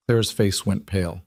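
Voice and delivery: ordinary delivery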